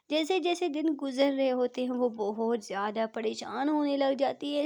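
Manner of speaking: ordinary delivery, nothing marked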